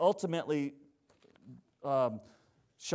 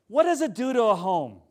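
normal talking voice

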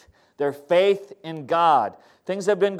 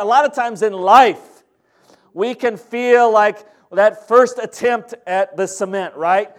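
ordinary delivery

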